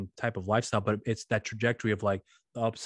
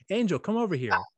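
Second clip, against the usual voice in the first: imitating a cousin calling across the room, higher; 8 semitones higher than usual. Cousin calls the son over to ask about the song, pitched-up call.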